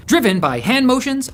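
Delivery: ordinary delivery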